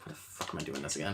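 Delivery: low, quick, hushed aside; mock grumbling. Old husband privately fed up with bringing flowers, mock grumbling. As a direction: grumble it under the breath in mock weariness.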